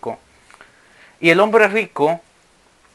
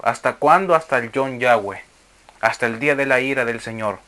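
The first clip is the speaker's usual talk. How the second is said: ordinary delivery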